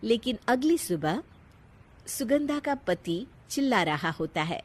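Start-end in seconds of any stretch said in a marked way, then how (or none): none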